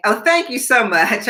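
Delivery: normal talking voice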